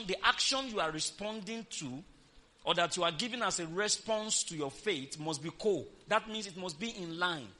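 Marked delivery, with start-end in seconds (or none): none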